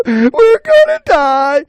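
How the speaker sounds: loud, high hammed-up panic; frightened. Frightened animals awaiting the bobcat, shrieking panic. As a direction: wail it at the top of the voice. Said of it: "the animals were very frightened".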